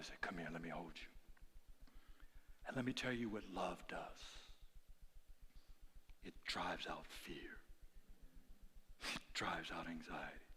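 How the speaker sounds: very soft, low, near-whisper; hushed tender preaching. Real, not acted. Preacher telling how God's love embraced him, hushed tender delivery. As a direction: let it sink almost to a whisper.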